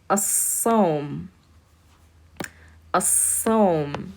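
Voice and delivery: As-Sawm with a held, doubled s; teacher models As-Sawm. Shown as a demonstration. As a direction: skip the l, hold the s in As-Sawm. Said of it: "It's a shadda".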